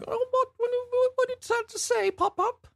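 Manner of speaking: squeaky, very high mock child voice; very high hammed-up little kid asking mum; British little kid. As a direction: play a bewildered tiny British child. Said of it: "tucking in their little soldier coats".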